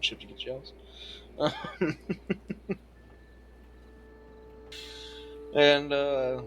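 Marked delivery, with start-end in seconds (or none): 0.8-5.5 breathy, wheezing burst of laughter; long laugh. Real, not acted. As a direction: laugh hard, almost without voice. Listener laughs at the tease.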